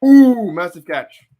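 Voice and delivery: long loud Ooh, then the call; impressed exclamation. Real, not acted. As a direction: burst out at a great play. Reacting live to a catch.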